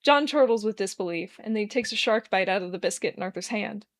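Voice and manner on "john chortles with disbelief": plain reading voice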